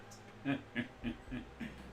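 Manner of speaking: breathy laugh. Acted. Character laughs.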